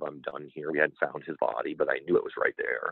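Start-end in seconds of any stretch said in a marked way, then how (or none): 0.0-0.7 soft, settled, to himself; softly resolved, a private finish; quiet satisfaction. Retold or imitated. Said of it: "the satisfaction of".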